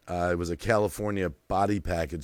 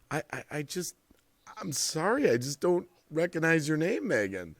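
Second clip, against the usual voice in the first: Ben, flustered; 6 semitones higher than usual. Retold or imitated. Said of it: "here's Ben going".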